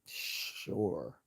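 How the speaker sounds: dry sarcastic sure. Real, not acted. Sarcastic retort.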